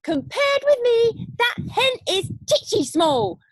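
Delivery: high, loud crowing call; grandma crowing over the hen; boastful sing-song. Acted. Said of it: "Grandma sang out".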